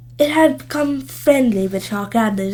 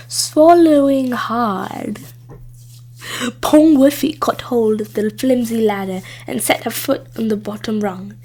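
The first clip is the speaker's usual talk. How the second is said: ordinary delivery, nothing marked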